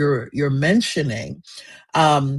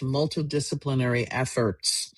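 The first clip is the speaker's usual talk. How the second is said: ordinary delivery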